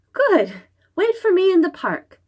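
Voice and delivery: put-on high, bright little girl voice; young girl voice. Xingling answering the monster in a high girl's voice. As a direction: chirp it like a clever little girl.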